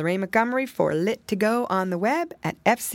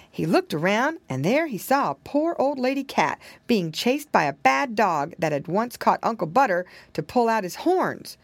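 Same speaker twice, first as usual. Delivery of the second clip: plain reading voice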